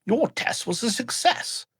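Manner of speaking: Wesker voice. Acted. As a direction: savour each word like a smug villain. Wesker voiced, high and slow.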